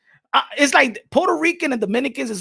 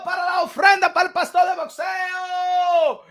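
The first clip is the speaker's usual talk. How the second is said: much higher and louder, slow stretched calls; hype announcer shout; 10 semitones higher, 10 dB louder than usual. Acted. Thanking Randy for his donation to the stream, hype announcer shout. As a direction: bellow the donor's name like a ring announcer.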